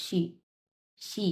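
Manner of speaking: "শি" short and lightly released; touch "শি" and let it go; neutral tone. Shown as a demonstration. Teacher demonstrates neutral tone, light syllable. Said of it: "তারপরে হচ্ছে neutral tone".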